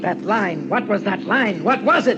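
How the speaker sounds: building high and loud; frantic. Acted. Obsessive building question.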